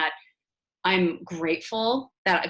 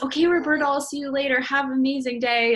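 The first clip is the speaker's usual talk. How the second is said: put-on higher, upbeat send-off to a child; retold cheery goodbye; 7 semitones higher, 4 dB louder than usual. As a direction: brightly cheer a little kid on, retold.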